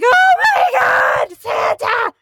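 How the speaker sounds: very high, loud squeal of joy; ecstatic child shriek. Retold or imitated. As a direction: shriek like a kid meeting Santa. Child overjoyed meeting Santa.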